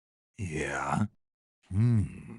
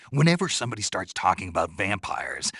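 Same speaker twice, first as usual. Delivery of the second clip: ordinary talk, nothing marked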